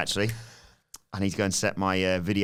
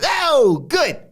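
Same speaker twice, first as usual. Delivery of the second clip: high, loud pleased Tony voice; hammed-up satisfied boss; Tony impression; 6 dB louder than usual. Tony pleased with Luca's report, high and loud. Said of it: "Tony says".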